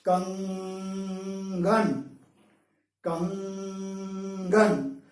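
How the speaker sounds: कंगन with the ng hummed long before गन; कंगन, nasal sustained on one note, then released; long-held nasal ng. Shown as a demonstration. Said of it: "लंबा खींचें और बाद में".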